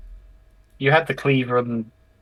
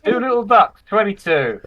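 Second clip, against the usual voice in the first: bingo caller; 7 semitones higher, slower than usual. Pretend. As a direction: shout bingo numbers from a tree. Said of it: "can anybody fake a bingo caller".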